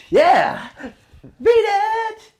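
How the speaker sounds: hyped crowd yell; excited shout. Retold or imitated. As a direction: whoop it out to the stage. Hyping the crowd at dueling pianos.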